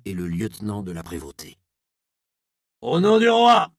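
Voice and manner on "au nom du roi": high, loud command in the king's name; lieutenant's shout. A narrator's reading. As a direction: bark it like an officer stopping a crime. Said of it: "s'exclama ce dernier". (cri autoritaire, aigu et fort)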